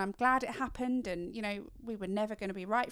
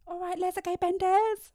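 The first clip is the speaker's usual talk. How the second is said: higher, slow, jolly uncle; uncle's voice; 10 semitones higher, 4 dB louder than usual. Retold or imitated. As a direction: boom it like a rowdy uncle. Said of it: "he's like, what does he say?".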